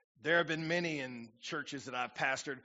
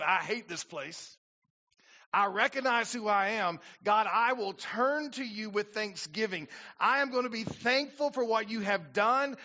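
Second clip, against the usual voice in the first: louder, higher preaching voice carrying the prayer; full-force preaching; 6 semitones higher, 5 dB louder than usual. Acted. Pastor preaching Jonah's prayer to the congregation, full force. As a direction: preach it out to the back row.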